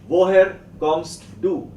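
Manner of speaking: emphatic classroom question for repeating; projected teacher modelling. Shown as a demonstration.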